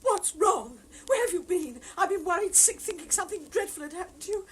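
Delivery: loud, anxious mother; worried scolding. Acted. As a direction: scold him out of worry.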